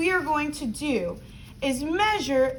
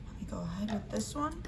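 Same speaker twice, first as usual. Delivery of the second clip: whispering; 3 semitones lower, 12 dB quieter, faster than usual. Real, not acted. Concentrating while cutting.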